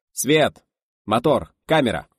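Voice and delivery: loud, separate one-word commands; called-out cues. A narrator's reading. Director cues the crew before a take, calling out. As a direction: fire each cue across the set.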